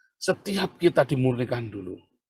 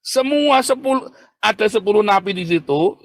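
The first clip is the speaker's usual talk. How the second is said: loud, high-pitched sermon outburst; impassioned preaching; 9 semitones higher, 8 dB louder than usual. Real, not acted. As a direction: preach it at full force, voice raised. Berkhotbah berapi-api. (khotbah berapi-api dengan suara tinggi dan keras)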